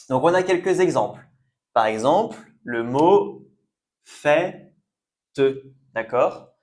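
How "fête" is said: FÊTE broken up, open "è" held alone and raised. Shown as a demonstration. Teacher showing learners the circumflex, "fête" syllable by syllable. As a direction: say "fête" in parts, starting on a clear "fè". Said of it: "on prononce bien est".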